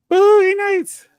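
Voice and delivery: high, loud announced title; exclaimed title. Acted. Listing Wahlberg films, loud and high. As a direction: call the title out like a reveal.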